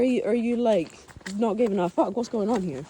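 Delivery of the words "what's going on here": ordinary talk, nothing marked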